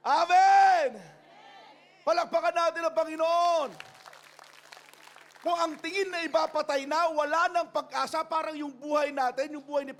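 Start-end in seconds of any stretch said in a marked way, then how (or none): 0.1-7.5 loud, high rallying cry; preaching shout. Real, not acted. Pastor urging church to clap for the Lord, loud. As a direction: lift the congregation to applause.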